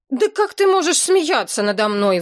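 sudden high indignant outcry; crying out. Acted. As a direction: cry it out, wounded and high. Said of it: "вдруг вскричала Галка".

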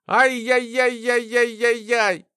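high, long "ay-yay-yay" wail; drawn-out string of plaintive yelps; complaining wail. A narrator's reading. Itching test subject complaining, high wailing. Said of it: "жаловался Артур".

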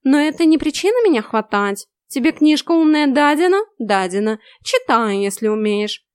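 loud, high sneering cat; snooty bored cat. A narrator's reading. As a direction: talk down to her like a tomcat. Cat mocking heroine.

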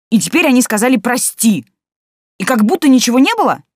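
loud hurt indignation; indignant outburst. A narrator's reading. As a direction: pour out the grievance loudly. Heroine angrily protests to principal.

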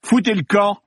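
high-pitched indignant outburst; angry dismissal. Acted. Film star to an intruding policeman, angry dismissal. Actor orders the cop away.

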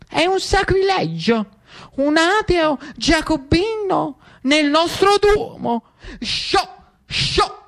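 high, slow scandalised pious man shooing; high indignant churchgoer; outraged old devotee. Acted. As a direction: shoo the heretic away. Devout man shoos the general.

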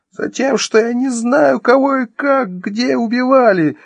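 very high, drawn-out scream; hysterical yelling. Acted. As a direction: scream it out at the end of her nerves. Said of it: "истошно заорала Вероника".

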